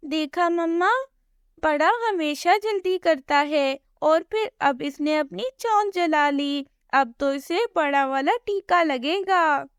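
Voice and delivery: a hint of a cheeky little sibling; tattling little chick. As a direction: tell on your brother to mum. Younger chick tattles to mother.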